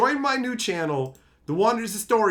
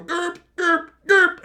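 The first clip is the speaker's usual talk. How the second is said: derp babbled much higher, louder on the end; mock brain-dead derp; 10 semitones higher, 5 dB louder, slower than usual. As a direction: play it dopey and vacant. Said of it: "they just find you going".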